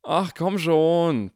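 loud, slow drawn-out protest. Acted. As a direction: groan it out in protest. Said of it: "protestierte Harry". (lauter, gedehnter Protest)

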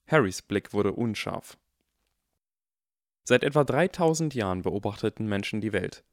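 ordinary delivery

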